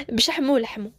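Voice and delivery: ordinary delivery, nothing marked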